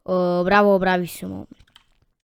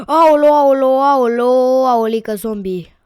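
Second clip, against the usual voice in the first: loud high yelp of alarm, easing off as it ends; gasp, then wail out the cry in a panic; 4 semitones higher, 7 dB louder than usual. Real, not acted. Gamer spots a zombie in the game, alarmed wail.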